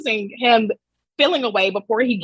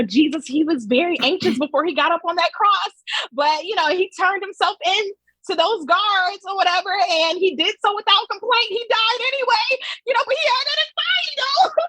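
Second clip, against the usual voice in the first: high-pitched mock explainer, climbing higher; mocking retelling; 8 semitones higher than usual. Critic mimics the link's story of Jesus, mocking and high. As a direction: parody a glib religious pitch, pitch rising to the end.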